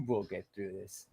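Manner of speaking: low, soft mock-sincere murmur; quiet scornful parody; mock solemn quote. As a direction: sneer it in a hushed fake-sincere voice. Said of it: "What a bunch of bullshit".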